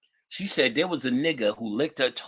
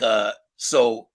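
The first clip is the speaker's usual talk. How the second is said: ordinary delivery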